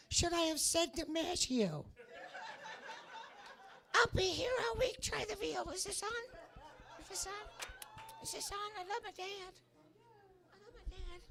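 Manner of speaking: very high puppet dog voice; talking puppy. Acted. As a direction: squeak it as a little dog doing stand-up. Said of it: "he goes".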